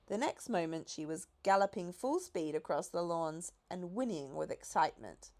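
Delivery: ordinary delivery, nothing marked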